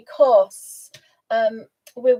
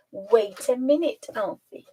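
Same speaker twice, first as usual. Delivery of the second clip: ordinary talk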